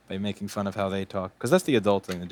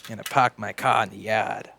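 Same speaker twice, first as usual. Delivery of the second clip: mock Boston accent. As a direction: tease the Boston way of talking. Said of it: "they say things funnily".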